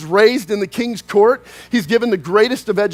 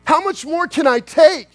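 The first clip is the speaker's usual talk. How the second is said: higher-pitched, fed-up complaint; exasperated protest; 6 semitones higher, slower than usual. Acted. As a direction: protest to God like you've had enough. Voices fed-up Israelites before God.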